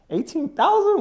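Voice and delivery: high, stunned "eighteen thousand?"; retelling the moment he saw the limit, stunned; shocked disbelief. Retold or imitated. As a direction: squeak it out in disbelief.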